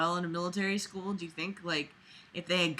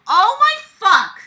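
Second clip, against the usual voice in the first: high, loud and slow cry of disbelief; exasperated outburst; 13 semitones higher, 13 dB louder, slower than usual. Real, not acted. Fed up with the story's principal, a loud exasperated cry. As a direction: let the frustration burst out.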